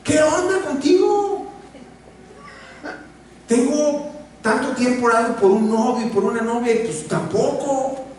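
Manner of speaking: high, slowed, whining mock prayer; whiny complaining prayer. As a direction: pray like a spoiled complainer. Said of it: "como si yo orara y dijera". (oración quejumbrosa, aguda y burlona)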